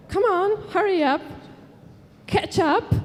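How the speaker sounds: high-pitched hurrying tomato dad; father tomato voice. Acted. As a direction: squeak out the impatient father. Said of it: "the father tomato says".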